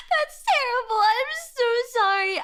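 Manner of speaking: high, laughing through a mock apology; laughing sympathy. Real, not acted. Reacting to a chat story, laughing high. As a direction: laugh while saying sorry, slowly.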